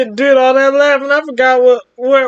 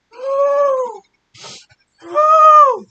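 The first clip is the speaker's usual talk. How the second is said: excited whoop; 12 semitones higher than usual. Real, not acted. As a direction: whoop it out, overcome. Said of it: "I'm gonna keep laughing".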